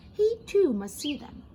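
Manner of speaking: plain reading voice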